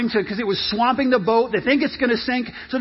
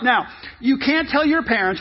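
ordinary delivery

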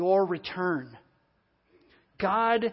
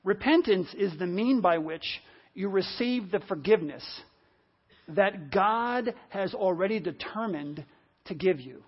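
slow, measured delivery with pauses between phrases; slow dictation. Real, not acted. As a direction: say it slowly enough to write down. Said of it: "I'll try to say it slowly".

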